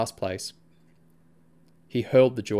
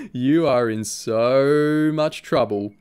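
gloating friend; 3 semitones higher, 7 dB louder, much slower than usual. A narrator's reading. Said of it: "Sam said, laughing".

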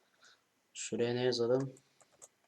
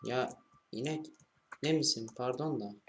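ordinary delivery

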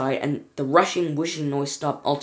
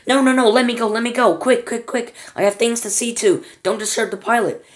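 frantic; 7 semitones higher, 4 dB louder than usual. Acted. Wonka fights to be let go.

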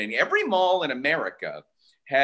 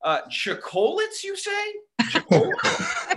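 higher, wide-eyed mock ignorance; fake-naive customer; 11 semitones higher than usual. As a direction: play dumb about the chocolates. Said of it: "So I'm like".

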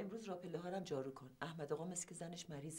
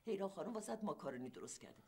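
usual speaking voice

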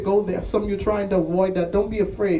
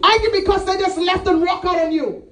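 high, raised shout; impassioned preaching cry; 9 semitones higher than usual. Acted. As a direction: cry out the refrain. Preacher cries out refrain of anger.

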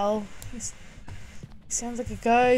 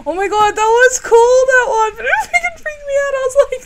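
excited; 13 semitones higher, 9 dB louder than usual. Real, not acted. Thrilled after the scare.

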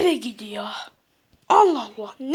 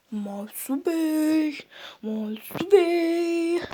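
slow, softer call of his name; gently trying to wake a patient; 5 semitones lower, 8 dB quieter, much slower than usual. Acted. Nurse calling unconscious patient.

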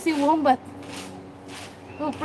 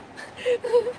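teary laugh; 10 semitones higher, 5 dB quieter than usual. Real, not acted. Overwhelmed with joy.

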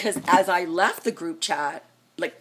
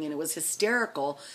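usual speaking voice

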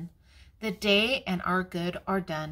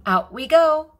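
high, loud, eager call to go out; excited call; 8 semitones higher, 10 dB louder than usual. Acted. Grandma reading, child eager to go outside, loud and high. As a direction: burst out the door with it.

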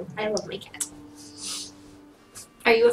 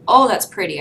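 "Oh, that's pretty" said again much louder; louder excited repeat; 9 dB louder than usual. Shown as a demonstration. Player admiring a new golden card, louder and delighted.

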